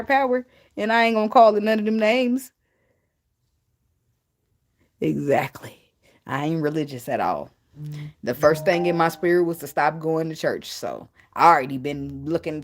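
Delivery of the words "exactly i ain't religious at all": whisper. Real, not acted. Confiding aside to viewers.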